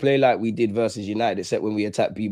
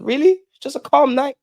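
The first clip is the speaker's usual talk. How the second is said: surprised; 13 semitones higher, 5 dB louder than usual. Real, not acted. Surprised at the news.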